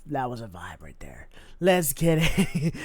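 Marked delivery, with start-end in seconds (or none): 0.1-1.3 whisper. Real, not acted. Hushed repeat after song ends.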